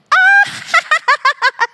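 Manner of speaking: high, loud imitated cackle; crazy laugh. Servant woman laughing at the touch, imitated crazy laugh. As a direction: burst into a wild, piercing mock laugh. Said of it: "It was like this crazy laugh".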